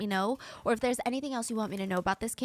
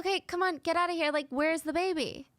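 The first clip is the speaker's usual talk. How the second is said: put-on higher, half-joking mom asking where the baby is; puzzled mother; 7 semitones higher than usual. Mother to her son, a lightly voiced puzzled mom. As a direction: brush off a kid's prank, a bit high.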